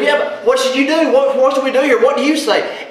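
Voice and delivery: insistent accusers; pushy accusers. Acted. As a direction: press Jesus for an answer. Accusers pressing Jesus louder.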